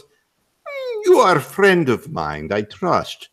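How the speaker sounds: put-on Russian tough guy accent. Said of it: "he goes".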